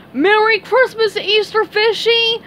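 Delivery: high, loud cartoon greeting; gleeful Patrick. A narrator's reading. Patrick greets the fish while running off, gleeful. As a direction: call it out high and merry. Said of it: "said Patrick".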